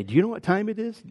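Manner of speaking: a flash of mock annoyance at the hour; half-joking huffy question; mock exasperated retort. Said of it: "you wanna say".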